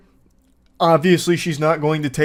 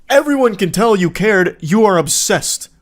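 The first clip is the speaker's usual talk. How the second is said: exasperated outburst; 5 semitones higher, 5 dB louder than usual. Real, not acted. Host exasperated at creepy texter.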